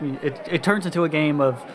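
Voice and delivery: normal talking voice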